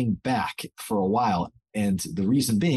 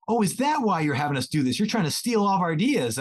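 higher-pitched imitation of pupils; mock-suspicious schoolchildren, pitched up; accusing kids; 8 semitones higher than usual. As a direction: playfully voice kids catching teacher out. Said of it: "And they're like".

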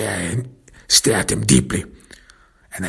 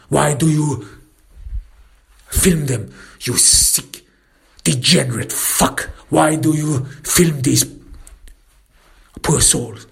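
higher-pitched angry demand; angry confrontation; 5 semitones higher than usual. Acted. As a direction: demand an answer, accusing.

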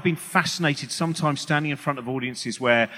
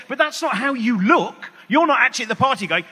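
ordinary talk, nothing marked

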